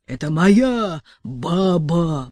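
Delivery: slow, rasped and choked; hoarse rasp. A narrator's reading. Petka wheezing his claim while held by the throat, slow rasp. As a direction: rasp it out through a grip. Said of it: "прохрипел Петька".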